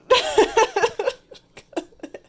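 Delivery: loud high-pitched laugh. Real, not acted. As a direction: burst into a high squeal of laughter. Big laugh after meme joke.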